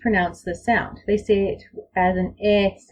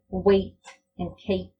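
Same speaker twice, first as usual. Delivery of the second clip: usual speaking voice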